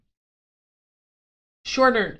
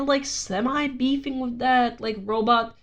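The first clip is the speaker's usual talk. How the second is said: usual speaking voice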